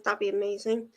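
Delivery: ordinary talk, nothing marked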